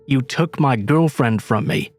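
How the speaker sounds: ordinary delivery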